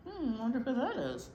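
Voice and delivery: usual speaking voice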